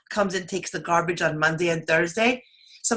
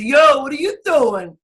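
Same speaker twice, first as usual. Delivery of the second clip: loud, higher, drawn-out Brooklyn accent; Brooklyn Queens accent; 6 semitones higher, 6 dB louder than usual. Shown as a demonstration. Host showing how Brooklyn greets you, Brooklyn accent. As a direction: call it out in Brooklyn-Queens style. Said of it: "That's, like, the Brooklyn Queens".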